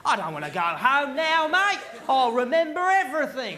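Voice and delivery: put-on drunk Australian. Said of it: "no one ever wants to go home in Australia".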